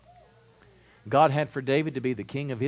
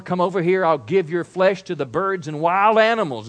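loud, higher threatening yell; yelling giant; 6 semitones higher, 8 dB louder than usual. Retold or imitated. Preacher voicing Goliath yelling at David, loud. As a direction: roar the threat across the valley. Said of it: "Goliath yelled".

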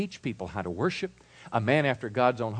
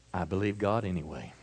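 softer, lower, settled statement of faith; calm declaration; 5 semitones lower, 5 dB quieter than usual. Shown as a demonstration. Preacher showing the congregation how to answer calmly. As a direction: say it quietly after a deep breath. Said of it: "take a deep breath and say".

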